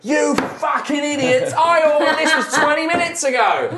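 high, loud, furious kitchen tirade; enraged chef yelling at his staff; shouting half-orc chef. Acted. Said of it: "who is just shouting at the staff".

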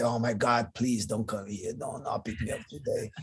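a hushed, dreading prayer, trailing to a whisper; hushed dreading plea. Retold or imitated. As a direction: beg under your breath that he won't come. Dreading the professor's arrival.

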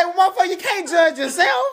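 high, laughing, breaking voice; laughing mock rant. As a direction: cackle through the comeback. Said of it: "Shannon say".

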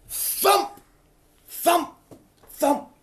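high, punchy "thump", spaced out; spoken thumps. Acted. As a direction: imitate arrows thudding, one at a time. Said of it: "You know what the noise is?".

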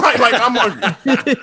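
laughing. Real, not acted. Repeats punchline laughing.

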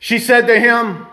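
ordinary talk, nothing marked

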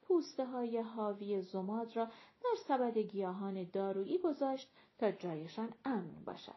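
ordinary delivery, nothing marked

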